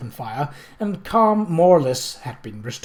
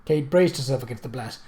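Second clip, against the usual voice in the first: usual speaking voice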